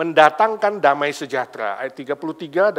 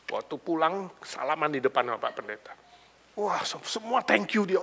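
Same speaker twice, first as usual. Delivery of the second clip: ordinary delivery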